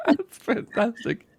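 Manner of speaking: high laughter spilling into the words; laughing. Real, not acted. Host laughing at the guest's story, laughing. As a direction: laugh it out, amused.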